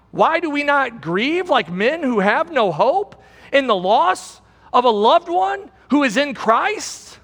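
usual speaking voice

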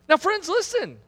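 high, urgent appeal to the congregation; impassioned preaching. Real, not acted. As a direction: press each item home with a falling stress.

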